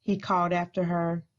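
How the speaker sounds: read-aloud picture book voice, unhurried; story narration. Acted. School librarian reading Library Lion aloud to children, gentle storytelling. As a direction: tell the story to a room of kids.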